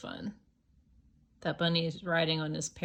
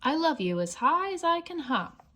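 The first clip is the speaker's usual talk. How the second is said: high, bouncy bunny; little hare voice; 9 semitones higher, 4 dB louder than usual. Acted. Little hare hopping up and down, high. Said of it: "laughed Little Nut Brown Hare".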